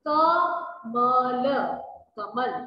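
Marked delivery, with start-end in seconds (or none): 0.1-1.4 कमल stretched very slowly and loud; कमल spoken very slowly and clearly; drawn-out demo word. Shown as a demonstration. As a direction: say कमल slowly, syllable by syllable, for the class. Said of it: "teacher demonstrates the word".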